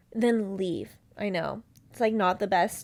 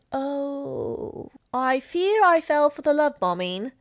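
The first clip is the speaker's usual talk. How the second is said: a lightly hammed-up, higher tragic "I fear"; mock dramatic lament; 4 semitones higher, slower than usual. Host mocking herself for falling for love bombing, mock-tragic. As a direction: play it as a mock melodrama heroine. Mocking her past naive self.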